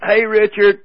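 loud, high shout; yelled greeting. Retold or imitated. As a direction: holler across a stadium. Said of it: "I yelled out".

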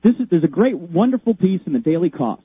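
ordinary delivery

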